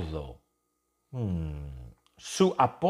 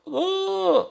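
mouth-opening gasp. Pretend. People open mouths.

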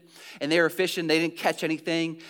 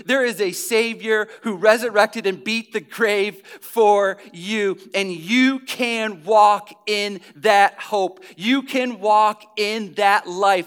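louder, driving preacher cadence; preaching at full force; 3 semitones higher, 4 dB louder than usual. Acted. Pastor urging his Sunday congregation, louder full-force preaching. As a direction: preach it hard to the whole room.

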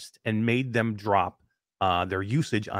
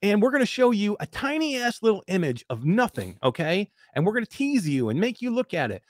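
higher boastful company voice; mocking HTC; 9 semitones higher than usual. Mocking HTC's empty product teasers, boastful voice. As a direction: puff up like a bragging brand. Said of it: "they're like".

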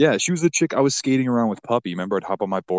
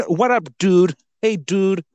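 high, loud put-on Boston dude; jokey high-pitched Boston dolphin voice; attempted Boston accent; 10 semitones higher, 5 dB louder, slower than usual. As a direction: call out in a rough stab at Boston. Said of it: "I think mine was more Boston".

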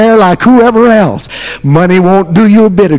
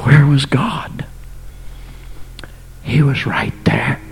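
hushed whisper after the storm; a sudden whispered secret; 2 semitones lower, 9 dB quieter than usual. Acted. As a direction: lean in and whisper it. Hushed answer after loud preaching.

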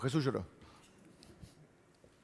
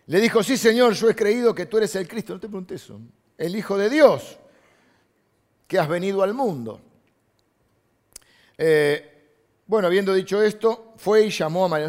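loud, raised Bible reading; emphatic scripture reading; 6 semitones higher, 8 dB louder than usual. Acted. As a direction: proclaim the verse to the congregation. Preacher reads John eleven aloud.

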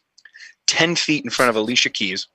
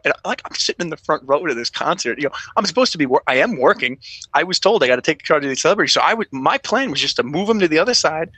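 usual speaking voice

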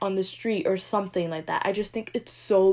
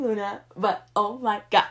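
usual speaking voice

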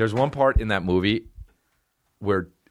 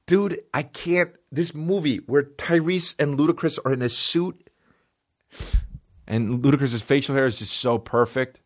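overwhelmed rant; 8 semitones higher than usual. Real, not acted.

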